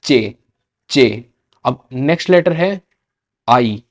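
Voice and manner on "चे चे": चे as Spanish "ch"; Spanish sound. Shown as a demonstration. Teacher to students, Spanish ch sound. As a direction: model चे clearly. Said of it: "उसकी sound ही अलग होगी".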